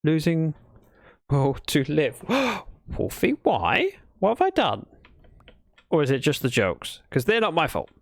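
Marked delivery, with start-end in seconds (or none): 2.3-4.9 gasping, high-pitched mock anguish; playful wounded protest, pitched high; mock despair. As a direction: plead in hammed-up dismay. Mock despair over bad jokes.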